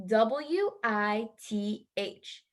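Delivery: W I T H spelled slowly, one letter at a time. Shown as a demonstration. Teacher spelling W I T H slowly for the class, letter by letter. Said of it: "Our next word is with".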